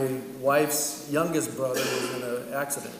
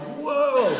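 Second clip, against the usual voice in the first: high surprised whoa; startled whoa; 10 semitones higher, 4 dB louder than usual. Retold or imitated. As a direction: caught off guard on the bike. Bike suddenly tilts.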